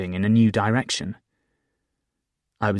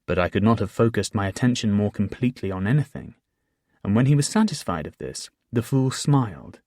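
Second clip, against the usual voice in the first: usual speaking voice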